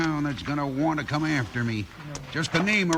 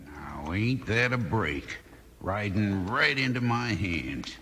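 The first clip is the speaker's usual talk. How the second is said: sly gloating; 8 semitones lower, slower than usual. Acted. Villain gloats over trap.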